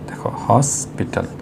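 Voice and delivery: হসপিটাল whispered slowly with the H clearly sounded; softly and slowly breathe out হসপিটাল, H voiced at the start; whispered demo. Shown as a demonstration. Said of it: "এইচের উচ্চারণটা হয়ে যাচ্ছে এখানে হসপিটাল". (ফিসফিস করে ধীরে, এইচ স্পষ্ট রেখে হসপিটাল)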